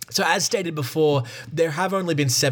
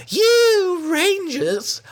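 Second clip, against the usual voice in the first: very high, very slow and louder falsetto; lady voice; 4 dB louder, much slower than usual. Acted. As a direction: drag it out like an outraged lady. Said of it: "I've just gone back into the other lady".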